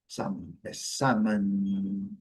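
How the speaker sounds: salmon slow with the final N stretched; drawn-out salmon. Shown as a demonstration. Teacher lengthening the N for students. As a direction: linger on the N of salmon, slowly. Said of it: "Alongando o N".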